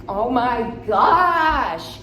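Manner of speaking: loud, high, very drawn-out exclamation; excited gush. Retold or imitated. Retelling being asked out for a drink, giddily excited. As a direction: stretch it into a giddy squeal.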